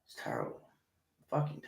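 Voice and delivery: low whispered verdict; whispered aside. Real, not acted. Host muttering to listeners, whispered aside. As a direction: hiss it under your breath.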